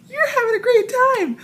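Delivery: high, loud showbiz cheer; gleeful call. Retold or imitated. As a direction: imitate a beaming performer calling out. Imitates dancers calling to her.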